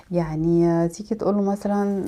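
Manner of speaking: ordinary talk, nothing marked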